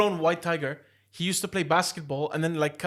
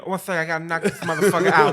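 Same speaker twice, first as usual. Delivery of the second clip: louder, laughing tough-guy boast; mock Tyson boast; 9 dB louder than usual. Imitating Tyson about to punch the tiger, laughing loud boast. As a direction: puff up like you'll knock it out. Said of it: "Then hear him".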